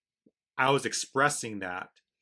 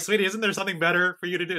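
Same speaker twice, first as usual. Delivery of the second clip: higher, louder, quick retold dad voice; retold parental nudge; 7 semitones higher, 5 dB louder, much faster than usual. Dad to eavesdropping daughter, retold high, quick nudge. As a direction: imitate an amused parent's rapid, lifted plea.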